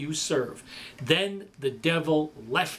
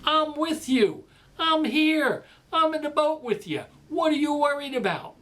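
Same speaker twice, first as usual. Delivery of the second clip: reassuring Jesus; 8 semitones higher than usual. Pretend. Jesus reassuring disciples in the boat, earnest. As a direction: promise you are right there with them. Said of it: "His point was".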